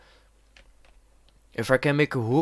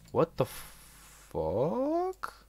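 quiet, slow, rising disbelief; drawn-out bafflement; 7 semitones higher, 8 dB quieter, much slower than usual. Real, not acted. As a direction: stretch it out as it climbs in pitch. Baffled by the game.

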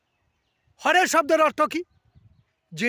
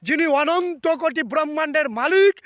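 ordinary talk, nothing marked

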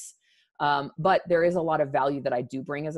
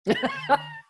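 high bright laugh. Real, not acted. Laugh after her point.

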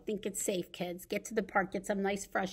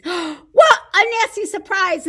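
high, loud alarmed cry; 11 semitones higher, 14 dB louder, slower than usual. Acted. Boy spots something through his telescope, alarmed cry. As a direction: gasp it out like a scared boy. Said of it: "Timothy cries".

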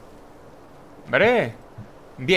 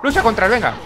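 urgent call; 6 semitones higher, 4 dB louder than usual. Real, not acted. Ordering companion in fight.

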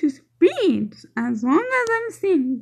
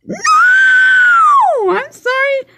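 mock scream; 14 semitones higher, 6 dB louder than usual. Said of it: "I was about to scream like".

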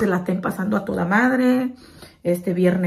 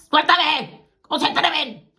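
high, loud outburst; mock outrage; shouting; 8 semitones higher, 5 dB louder, much slower than usual. Acted outraged exclamation.

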